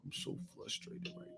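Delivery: frustrated fighter, barely above a whisper; Wilder impression. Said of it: "That's how Wilder be talking".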